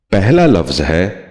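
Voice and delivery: ordinary delivery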